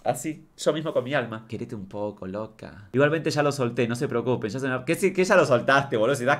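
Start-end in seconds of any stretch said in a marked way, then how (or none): 1.5-2.9 soft tender. Real, not acted. Tender self-talk.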